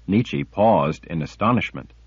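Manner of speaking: ordinary delivery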